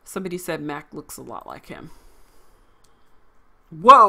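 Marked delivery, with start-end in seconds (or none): 3.7-4.1 startled whoa. Real, not acted. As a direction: jolt back in real surprise. Sees ugly new character.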